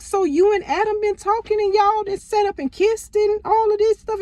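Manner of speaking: high, pushy confrontation; accusing. Retold or imitated. Confronting her cousin with the phone, high accusing outrage. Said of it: "threw her phone at her".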